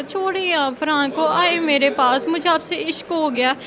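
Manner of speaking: slow, drawn-out mimicry of a lovestruck man; imitating blackmailer's pleading. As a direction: mimic his wooing, stretching each word. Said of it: "ऐसे बात वो कर". (आशिक की नकल में खींच-खींचकर बोलना)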